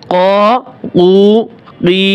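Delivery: ক, উ, ই each held long, separately; elongated vowels. Shown as a demonstration. Teacher reading without spelling out for learners, drawn-out syllables. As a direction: stretch each of ক, উ, ই for one alif. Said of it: "এক আলিফ করে টান দিবেন".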